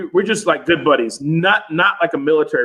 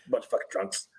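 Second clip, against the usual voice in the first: low, much softer muttered scorn; disgusted mutter; 6 semitones lower, 13 dB quieter than usual. Retold or imitated. Imitating his wife's look at the drunks, soft muttered scorn. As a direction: mutter it under your breath, disgusted. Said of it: "looking at us like".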